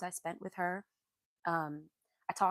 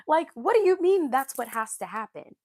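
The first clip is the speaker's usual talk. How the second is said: loud, higher-pitched indignant question; outraged disbelief; 4 semitones higher, 9 dB louder than usual. Real, not acted. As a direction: demand it loudly, in total disbelief. Repeats outraged question to commenters.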